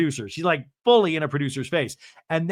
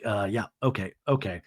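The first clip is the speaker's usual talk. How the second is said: low resigned mumble; 7 semitones lower, 6 dB quieter than usual. Retold or imitated. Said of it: "John just being like".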